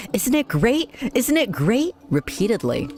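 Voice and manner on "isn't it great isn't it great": high, excited cheering crowd call; townspeople shouting. A narrator's reading. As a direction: call out joyfully like a festival crowd. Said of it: "I heard the townspeople shouting".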